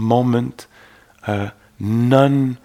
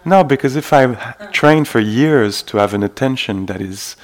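normal talking voice

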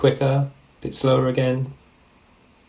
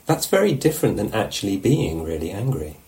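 ordinary delivery

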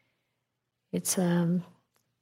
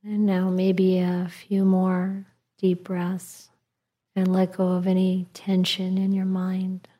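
calm meditative tone. Acted. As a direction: ease them into letting go. Guides breathing in meditation.

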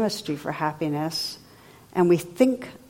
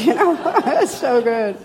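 laughing; 7 semitones higher, 6 dB louder than usual. Real, not acted. Amused by the study's wording.

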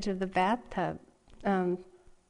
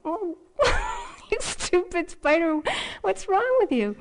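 high, louder mock scolding with a laugh breaking in; mock angry scolding; 11 semitones higher, 3 dB louder than usual. At the spider in the shower, mock angry high scolding. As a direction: scold the spider in half-laughing fake anger. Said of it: "I was really mad at the spider".

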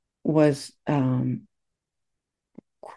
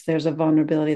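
usual speaking voice

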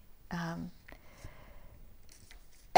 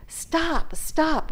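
mock yell, higher and a bit louder; half-performed shout, pitched up; retold yell; 8 semitones higher, 5 dB louder than usual. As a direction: reenact yelling at an attacker. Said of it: "as loud as I could".

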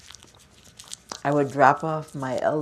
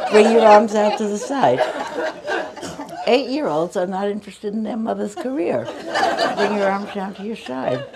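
laughing; 8 semitones higher, 6 dB louder than usual. Real, not acted. Teacher giving arm cues while still laughing at her story, laughing voice.

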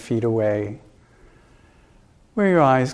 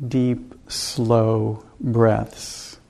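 very slow, each word stretched and set apart; slow, drawn-out; much slower than usual. Real, not acted. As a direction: pace the listeners' breathing with long, spaced words. Pacing listeners' slow breathing.

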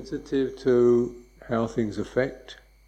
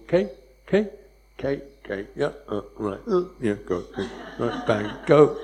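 quick clipped okays, mock hurried; hurried mock dismissal; rushed brush-off. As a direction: imitate someone rushing past, brushing people off. Mimics hurried brush-off of people.

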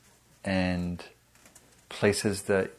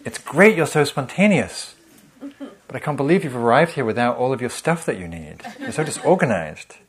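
higher and louder, a fake compliment turning to scolding; two-faced inner critic; 5 semitones higher, 5 dB louder than usual. Inner critic praising then scolding, higher and louder. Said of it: "the critic likes to take sides".

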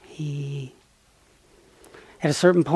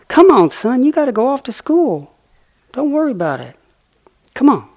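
higher, louder coaxing mother voice; 10 semitones higher, 8 dB louder than usual. Retold or imitated. Mother squirrel sends her son off to school, high coaxing voice. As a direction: push the little one out the door, high and bright.

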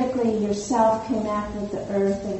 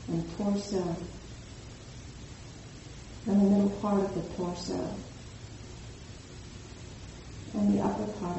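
soft spa whisper; 4 dB quieter than usual. Acted. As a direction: ease them gently through the body. Guided body scan relaxation.

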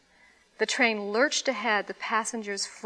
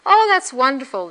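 delighted; 4 semitones higher, 9 dB louder than usual. A narrator's reading. Old man delighted by drunk.

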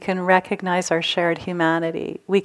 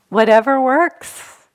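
louder, higher and slow, with a rising lift; emphatic; 6 semitones higher, 6 dB louder, slower than usual. Real, not acted. Teacher declaring a motto to the class, emphatic. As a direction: call it out as a cheerful maxim.

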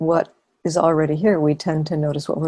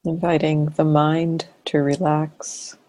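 guided meditation. Real, not acted. Teacher leading a sitting to relax and notice awareness, guided meditation. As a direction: invite them gently into awareness, phrase by phrase.

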